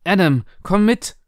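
anxious wife urging her husband along; urgent call. A narrator's reading. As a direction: call him sharply to follow. Mother urgently calls husband away.

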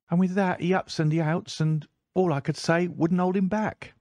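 put-on high, eager young servant boy; a breathless boy at the door; page boy voice. A narrator's reading. Page boy finishing his report.

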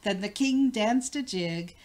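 ordinary delivery

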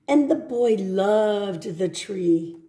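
gentle storybook read; reading to children. Acted. Teacher reading a picture book to children.

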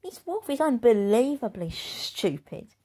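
a snotty bully sneering; big bad girl. Girl mocks the wolf.

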